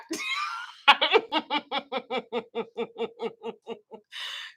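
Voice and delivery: high screaming laugh; shrieking laugh. Real, not acted. Teller howls laughing at her dad's line. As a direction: howl with laughter.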